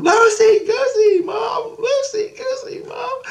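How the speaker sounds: very high squeal, drawn out; shrieky child chant, calling for Mom; mimicked excited kid. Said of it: "goes running to her mom".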